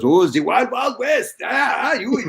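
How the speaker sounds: high, loud teasing German coach voice; mocking German coach. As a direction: ham up a German coach jeering at the cowboy. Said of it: "you know how the Germans are. They're funny".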